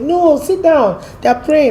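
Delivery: high, drawn-out retold telling-off; mock insistent refusal. Friend stopping her at a prayer gathering, mock insistent. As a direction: imitate a friend insisting, pitched well up.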